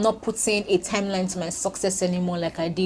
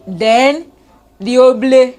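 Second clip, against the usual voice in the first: much louder, higher playful exclaim; excited; 5 semitones higher, 10 dB louder than usual. Real, not acted. Vlogger joking about holiday plans, excited. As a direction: bounce the slang word gleefully.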